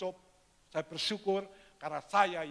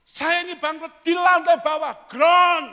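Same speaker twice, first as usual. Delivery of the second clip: much higher, louder frustrated yell; angry shout; 12 semitones higher, 15 dB louder than usual. Retold or imitated. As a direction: shout it at the pastor. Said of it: "Pak Pendeta dengar". (teriakan marah yang dibuat-buat)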